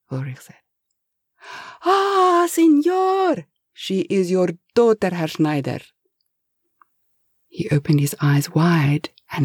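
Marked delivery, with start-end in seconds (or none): 1.8-5.8 put-on Spanish-accented, desperate plea, slow; pleading Spanish accent. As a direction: beg the boss in a Spanish accent. Eustasio guesses excitedly.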